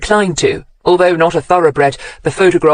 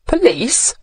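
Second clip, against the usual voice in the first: a startled, higher "Police?"; surprised echo; 8 semitones higher, 3 dB quieter than usual. A narrator's reading. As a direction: echo the word in mild disbelief.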